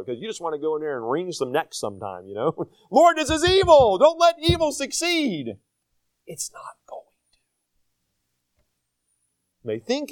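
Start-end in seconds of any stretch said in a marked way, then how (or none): 2.9-5.6 mock pleading prayer. 6.3-7.4 whispered reply, no voice at all; whispered divine reassurance. Retold or imitated. God's answer, whispered.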